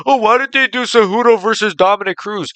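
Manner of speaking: high, whiny mock voice; mocking complainers. Mocking fans complaining about the matchup, high whiny voice. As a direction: whine the question like a crying fan. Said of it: "bro, stop fucking crying".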